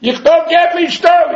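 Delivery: slow, drawn-out yell, pitched high; long, loud, desperate cry up the shaft; retold scream from a well. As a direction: call for help from the bottom of a well. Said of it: "So he screams".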